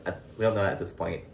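ordinary talk, nothing marked

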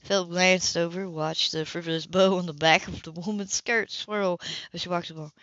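usual speaking voice

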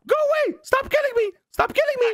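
high squawking angry bird, shrieking; angry parrot voice. Acted. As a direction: screech it out like a furious parrot. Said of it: "I can do a rad little freaking angry parrot voice".

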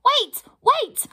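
high, loud panicked squeak; squeaking pixie. Acted. Pixie squeaks in panic at the troll's threat. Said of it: "T P squeaks".

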